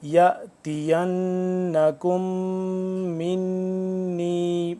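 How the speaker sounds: very slow tajweed, humming nasals in يَأْتِيَنَّكُمْ مِنِّي; triple ghunna. Shown as a demonstration. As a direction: chant يَأْتِيَنَّكُمْ مِنِّي with three long nasal hums on n and m. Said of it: "یہ تینوں جگہوں پہ غنہ ہوگا".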